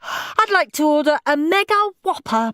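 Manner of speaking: put-on high-pitched, pleased child; eager high child, politely ordering; boy ordering pizza. A narrator's reading. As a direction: place the order proudly in a boy's voice. Henry on the phone ordering.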